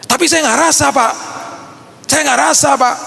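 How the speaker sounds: high-pitched mimicked complaint; protesting believer. Believer objecting to the pastor, mock high-pitched protest.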